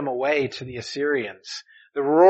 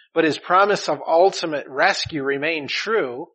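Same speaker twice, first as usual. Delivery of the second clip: ordinary talk, nothing marked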